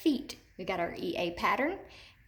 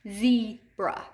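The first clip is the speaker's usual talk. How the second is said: "zebra" with a louder, held zee, then a separate bra; teacher demo of "zebra", first syllable strong and set apart; long E first syllable; 5 dB louder than usual. Shown as a demonstration. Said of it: "before our chin hits our hand".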